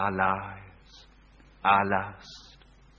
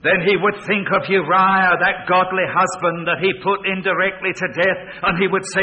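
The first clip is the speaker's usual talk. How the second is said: loud, high, driving pulpit voice; thundering sermon delivery; full-force preaching; 8 semitones higher, 12 dB louder than usual. Acted. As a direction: preach it out to the back row.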